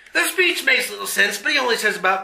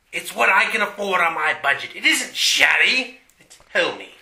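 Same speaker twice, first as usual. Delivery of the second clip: usual speaking voice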